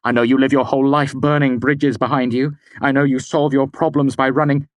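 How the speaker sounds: high, loud tirade; angry. A narrator's reading. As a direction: accuse her relentlessly. Kvothe lashes out.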